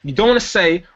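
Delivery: ordinary talk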